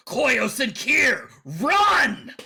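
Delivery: big deep werewolf shout. Acted. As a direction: bellow it like a werewolf. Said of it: "they know my big, deep werewolf voice".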